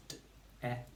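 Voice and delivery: "d-" and "et" split into short separate sounds; sounded out. Shown as a demonstration. Teacher has pupils build the word, sounded out. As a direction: segment "debt" as "d-", short e, crisp t. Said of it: "Three sounds".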